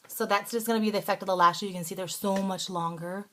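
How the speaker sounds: usual speaking voice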